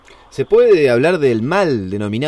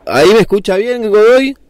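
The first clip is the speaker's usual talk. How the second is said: higher and louder, checking he hears; calling out; 6 semitones higher, 5 dB louder than usual. Real, not acted. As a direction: raise the voice to check the line. Checking the line is fixed. (llamando más alto)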